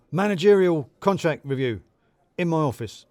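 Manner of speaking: brisk boss summons. A narrator's reading.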